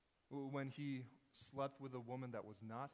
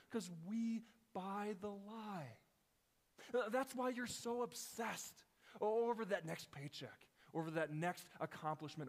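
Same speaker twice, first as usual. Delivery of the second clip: impassioned preaching; 7 semitones higher, 3 dB louder than usual. Acted. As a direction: press the truth home with urgency. Preacher climbs to impassioned peak.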